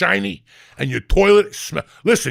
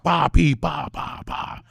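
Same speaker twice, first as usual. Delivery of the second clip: imitated pouring noises; 3 semitones lower, 4 dB quieter, slower than usual.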